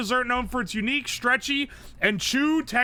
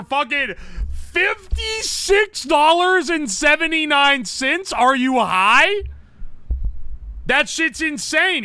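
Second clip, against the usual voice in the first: high and loud, slowed-down disbelief; stunned by the price, drag it out; loud incredulous rant at a ridiculous price; outraged; 6 semitones higher, 6 dB louder, slower than usual. Real, not acted. Outraged at a stadium food price.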